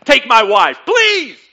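loud comic plea, rising high at the end; hammed-up loud begging; punchline. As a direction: belt it out like an old one-liner.

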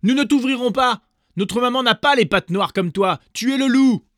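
very high, loud cry; shouting kids. A narrator's reading. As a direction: scream it at the wolf. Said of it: "crièrent".

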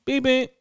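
two high, light horn toots; little nice beep. Retold or imitated. Driver giving the car in front another gentle high beep. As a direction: imitate a polite tap on the horn. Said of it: "new, little nice beep".

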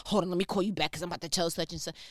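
rapid-fire mock phone chatter; breathless-paced imitation of a busybody; hurried. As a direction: rattle it off in a rush. Acted hurried urge to call back.

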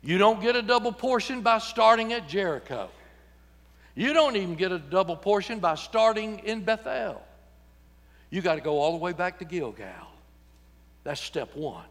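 normal talking voice

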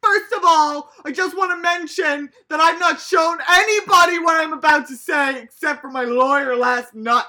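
furious nominee ranting, half laughing; aggressive. Retold or imitated. Nominee defending himself at the hearing, mock aggressive shout. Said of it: "He's so aggressive about it".

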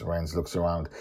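ordinary delivery, nothing marked

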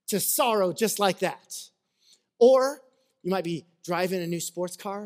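normal talking voice

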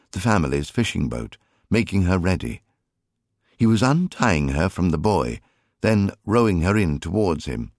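plain reading voice

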